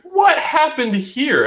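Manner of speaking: high, drawn-out "what happened here?"; mock bewilderment. Acts out his bewildered realisation.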